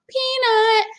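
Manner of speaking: high call for the mouse. Acted. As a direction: Annie calls into the shadows. Annie calling the mouse.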